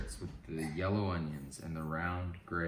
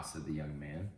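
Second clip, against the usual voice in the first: plain reading voice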